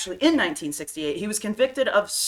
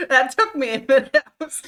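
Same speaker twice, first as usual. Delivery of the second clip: laughing; 6 semitones higher, 6 dB louder, faster than usual. Real, not acted. Laughing at the cereal pun.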